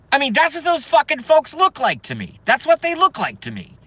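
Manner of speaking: high-pitched exasperated protest; worked-up, high complaint; exasperated rant. Real, not acted. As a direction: pitch it way up, then drop at the end. Exasperated rant about those folks.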